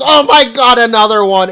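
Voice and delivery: high, loud panicked shriek; freaking out. Acted. Sid panicking at another pet, high loud shriek. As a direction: shriek it like a second pet just appeared. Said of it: "freaks out and goes".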